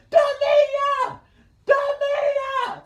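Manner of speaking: high, loud shout for his sister; calling out. Retold or imitated. Shouting for his sister Demia from the laundromat, high and loud. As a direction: yell her name across the street.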